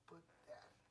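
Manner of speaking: unvoiced, coaxing hush; whisper. Preacher whispering the daughter coaxing her dad. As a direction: whisper as if sweet-talking him.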